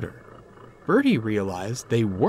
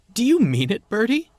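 hopeful; 12 semitones higher, 6 dB louder than usual. A narrator's reading. Thomas hopeful, louder, high.

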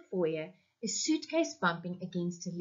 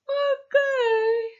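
put-on very high, drawn-out sleepy boy; sleepy child; 6 dB louder than usual. A narrator's reading. Boy waking up to his parents, sleepily. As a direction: stretch it out like a child just waking. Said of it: "said Paul sleepily".

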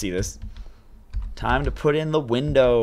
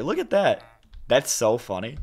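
ordinary talk, nothing marked

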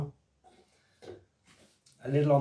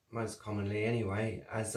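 ordinary talk

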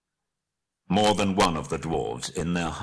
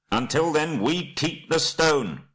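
high, ringing declaration; raised high voice, slowing for emphasis; cried out; 8 semitones higher, 4 dB louder, slower than usual. A narrator's reading. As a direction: call out the condition firmly. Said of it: "cried Bard".

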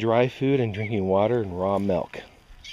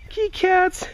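high kitty call; slower than usual. Real, not acted. Calling kittens.

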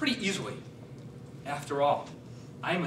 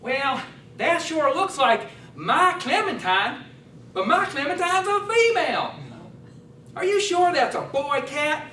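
Cletus doubting; 7 semitones higher, 4 dB louder than usual. Acted. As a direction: stretch it out high like a puzzled farmer. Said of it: "I'm Cletus".

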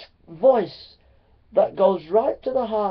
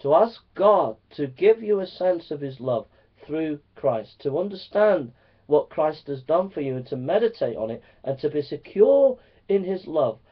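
normal talking voice

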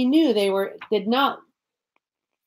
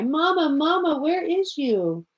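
put-on little child calling; 6 semitones higher than usual. A narrator's reading. Lost child crying in dark.

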